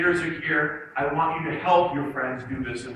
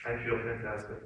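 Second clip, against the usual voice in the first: low, soft, downcast delivery at odds with the words; feeling sad; 6 semitones lower, 9 dB quieter than usual. Shown as a demonstration. Coach has students repeat happy words while slumped, sad. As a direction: say it with shoulders down and no spirit. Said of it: "In the way I say it".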